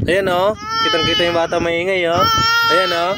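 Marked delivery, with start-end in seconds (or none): 2.7-3.1 put-on much higher, teasing baby-talk voice; squeaky baby talk. Mother teasing her angry baby in baby talk.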